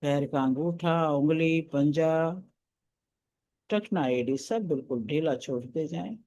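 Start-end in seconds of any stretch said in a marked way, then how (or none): none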